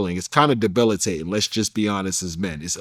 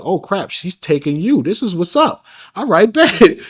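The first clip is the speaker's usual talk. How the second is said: excited thought; 7 semitones higher, 5 dB louder than usual. Retold or imitated. Excited inner reaction, laughing.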